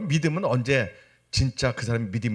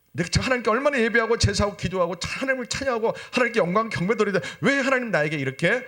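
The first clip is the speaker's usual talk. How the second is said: high, driving protest of why; resentful complaint; 10 semitones higher, 4 dB louder than usual. Acted. Believer asks God why he must suffer, resentful complaint. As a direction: plead and protest up high, question after question. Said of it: "하나님께 원망하고 불평해야 되는데".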